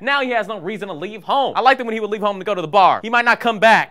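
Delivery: high, loud comic complaint; hammed-up upset, pitched high and loud; mock distressed outburst. Mock distress over alcoholic stepdad.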